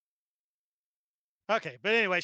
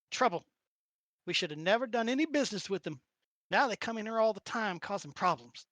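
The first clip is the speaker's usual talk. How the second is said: put-on Southern accent. Old lady warns strangers about orcs, Southern accent. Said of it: "Why don't you get Southern all of a sudden".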